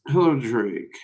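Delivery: normal talking voice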